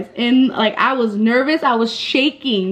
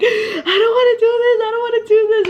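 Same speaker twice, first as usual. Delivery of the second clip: high-pitched panicky whine; mock a frightened friend's high wail; imitating scared friend; 12 semitones higher, faster than usual. Scared friend in haunted house, imitated.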